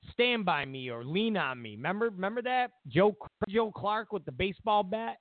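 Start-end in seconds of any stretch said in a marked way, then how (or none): none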